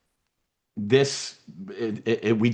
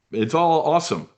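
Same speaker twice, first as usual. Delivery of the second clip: ordinary delivery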